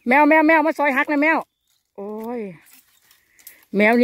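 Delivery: high, loud playful calling; teasing call out. Real, not acted. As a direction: sing out the name like calling a cat. Teasing call to husband Maew.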